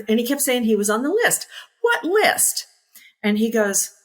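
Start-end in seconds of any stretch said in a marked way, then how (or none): 1.8-2.7 high, sharp disbelieving question; surprised. Retold or imitated. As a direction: throw it up in disbelief. Surprised question about the list.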